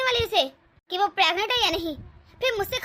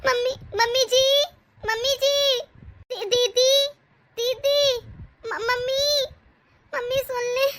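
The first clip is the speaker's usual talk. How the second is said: calling out; 3 semitones higher than usual. Acted. Pregnant daughter-in-law calling her mother-in-law from bed. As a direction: call out from the room for help. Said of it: "क्यों इस तरह चीखी जा रही है".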